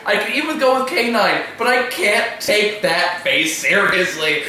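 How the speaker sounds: high-pitched, exasperated apology through amusement; pleading mock protest. Apologising to honey for laughing at Doctor Who, helpless protest.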